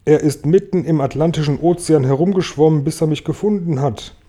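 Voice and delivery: usual speaking voice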